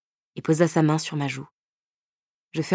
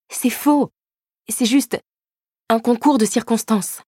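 shrill-free but much higher denial; much higher, indignant denial to her boyfriend; high protest; 7 semitones higher, 3 dB louder than usual. Acted. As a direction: push back fast and high. Heroine protesting sharply.

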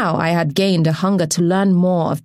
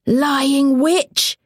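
a much higher, bellowed insult; thunderous shout; 8 semitones higher than usual. Acted. Man in the mob bellows at the accused woman, thunderous. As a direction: hurl it across the crowd. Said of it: "said a thunderous voice".

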